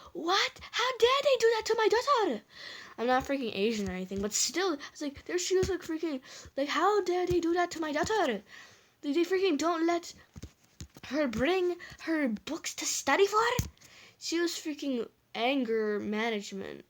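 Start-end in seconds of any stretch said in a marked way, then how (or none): 0.1-2.4 high, loud outraged mom; angry mom impression. Teller mimicking mom outraged for her daughter, angry. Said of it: "most angriest mom of all". 6.7-13.4 high-pitched mock-outraged mother; angry mom impression. Teller mimicking mom protesting school's book rule, angry. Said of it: "most angriest mom of all".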